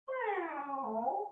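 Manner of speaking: high, soft meow with a rise; a kitten-like meow for the class; a little cat sound that tips up at the end. Shown as a demonstration. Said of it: "do another cat".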